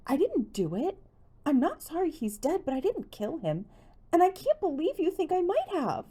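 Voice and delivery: high, loud, hurt protest rising to a cry; upset denial. A narrator's reading. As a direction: push back hard, near tears. Connie upset, accused of murder.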